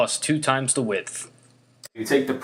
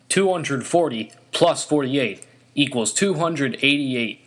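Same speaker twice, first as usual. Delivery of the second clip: ordinary talk, nothing marked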